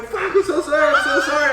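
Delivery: high, loud laughing panic; panicked apology. Retold or imitated. The narrator apologising to Kyborg in the channel, shouting and laughing in panic.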